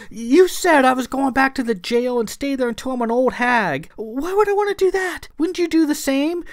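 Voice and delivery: high-pitched, frantic begging; desperate pleading. A narrator's reading. Clara pleads to Philip, the only one sympathetic, desperate. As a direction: plead shrilly for your life, protesting. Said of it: "She pleaded to Philip".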